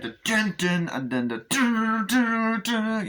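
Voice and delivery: high, slow sung dun dun; mimicking a horror soundtrack; imitated music stings. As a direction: hum the creepy score hits. Imitating creepy film score stings.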